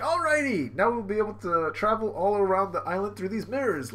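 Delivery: high, perky cartoon voice; cheerful Chopper. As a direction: chirp like a little anime sidekick.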